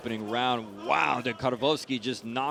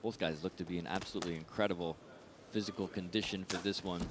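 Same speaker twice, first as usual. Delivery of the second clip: ordinary talk, nothing marked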